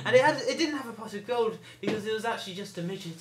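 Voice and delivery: bad Irish accent. Telling Ollie about a rainbow, bad Irish accent. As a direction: spin a tale in mock Irish. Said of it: "I'm doing an Irish accent".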